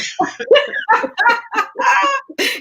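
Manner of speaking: long, high-pitched giggle; high laugh. Real, not acted. Laughing at own teasing.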